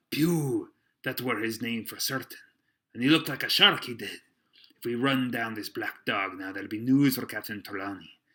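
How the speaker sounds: loud, excited seaman's voice; quite excited. A narrator's reading. Silver recognises Pew and urges the chase, excited. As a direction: rattle it out with rising excitement. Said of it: "now quite excited".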